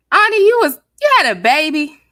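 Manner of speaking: high loud shocked outburst; surprised shriek. Real, not acted. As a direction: squeal it out in disbelief. Shocked reaction reading chat news.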